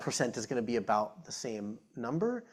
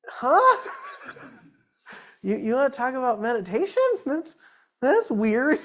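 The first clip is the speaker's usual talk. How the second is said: baffled surprise; 10 semitones higher, 7 dB louder than usual. Retold or imitated. His retold baffled reaction.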